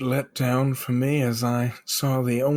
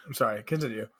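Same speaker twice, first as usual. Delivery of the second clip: ordinary talk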